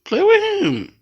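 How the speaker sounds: high, loud coaxing; urging the cat. Real, not acted. Owner urging her kitten to play with his brother, high and loud.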